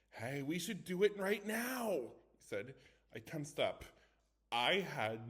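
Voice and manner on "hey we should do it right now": higher and louder, slow eager push; excited. Acted. Boy eager to summon the ghost now, excited. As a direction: relish the idea, drawn out and keen. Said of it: "a gleam of excitement".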